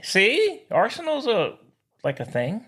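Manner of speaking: ordinary delivery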